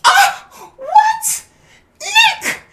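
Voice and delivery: very high, loud shrieking voice; imitating a shrieking outraged wrestler; shrieking outrage. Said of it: "Chelsea sees".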